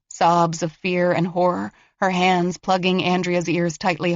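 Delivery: usual speaking voice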